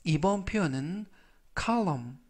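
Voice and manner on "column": column said clearly with silent n, KAL-um; model pronunciation. Shown as a demonstration. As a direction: demonstrate column for learners. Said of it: "column이라고 발음하시면 되겠고요". (column 발음 시범, n은 묵음)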